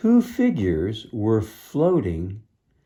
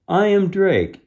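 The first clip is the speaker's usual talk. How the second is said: bold declaration; 10 semitones higher, 6 dB louder than usual. Acted. Hero answers the challenge.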